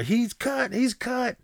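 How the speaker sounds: high-pitched imitation of a ringside commentator; excited commentator. Commentators announcing Drago's cut, high mock excitement. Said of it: "the commentators were".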